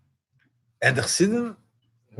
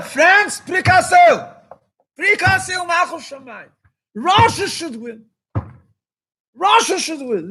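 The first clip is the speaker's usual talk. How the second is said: quoting the Rebbe; 10 semitones higher than usual. Retold or imitated. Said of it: "And Alter Rebbe said".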